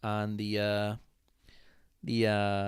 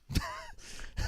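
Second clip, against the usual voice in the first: high laugh. Real, not acted. Laughs at own story.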